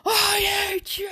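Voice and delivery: high, slow crying scream; anguished high scream of hate. Acted. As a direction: scream it through tears, pitched high. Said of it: "As Deku would scream".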